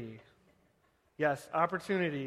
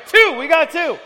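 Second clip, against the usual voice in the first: much higher and louder call; excited counting; 11 semitones higher, 14 dB louder than usual. Real, not acted. Pastor counts people during the game, excited loud call.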